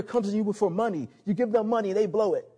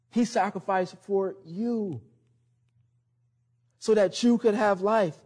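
usual speaking voice

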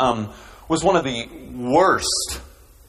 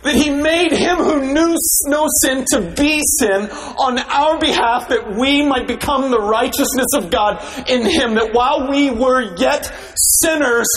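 much higher, louder fervent preaching; impassioned preaching; 13 semitones higher, 3 dB louder than usual. Acted. Pastor pressing the gospel point, impassioned. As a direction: preach it with rising fire.

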